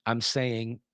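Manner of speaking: ordinary talk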